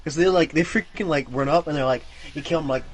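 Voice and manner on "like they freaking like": ordinary talk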